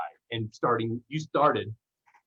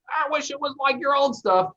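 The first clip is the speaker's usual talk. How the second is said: high, mocking whine of a disappointed fan; high nasal mock of a let-down fan; whiny fan impression; 3 dB louder than usual. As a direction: put on a whiny complaining-fan voice.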